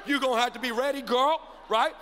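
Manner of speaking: normal talking voice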